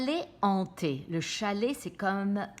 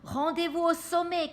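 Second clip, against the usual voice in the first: higher, louder call from the chairlift; Daphne calling out; 10 semitones higher, 4 dB louder than usual. A narrator's reading. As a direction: shout it up the slope to the others. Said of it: "crie Daphné".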